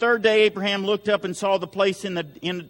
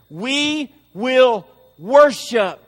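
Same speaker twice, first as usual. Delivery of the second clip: each word drawn out and louder; slow emphatic declaration; 2 semitones higher, 5 dB louder, much slower than usual. Retold or imitated. Abraham telling his servants his purpose, slow and emphatic. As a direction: weigh every word, slowly and firmly.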